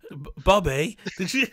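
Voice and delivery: loud mock impression of a friend's accent; Toby. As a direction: put on your friend's accent, loudly, breaking into laughter. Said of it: "That, in his accent".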